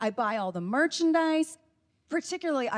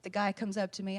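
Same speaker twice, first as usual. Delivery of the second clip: usual speaking voice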